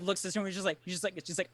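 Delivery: ordinary talk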